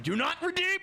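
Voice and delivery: high, yelped mock warning; hammed-up falsetto warning; meme voice. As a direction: squeal it as a joke. Meme line shouted at chat.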